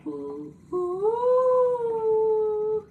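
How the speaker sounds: high, held ghostly wail; spooky ghost ooh. Pretend. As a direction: moan like a ghost. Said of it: "I'm just kidding, this place isn't haunted".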